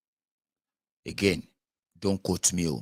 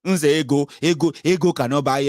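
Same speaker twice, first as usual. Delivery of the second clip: loud testimony; 5 semitones higher, 6 dB louder than usual. Retold or imitated. Church testimony crescendo.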